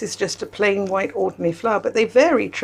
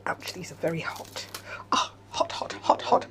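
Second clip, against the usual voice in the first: whispered hot hot; 3 semitones lower, 9 dB quieter than usual. Real, not acted. Touching very hot biscuits.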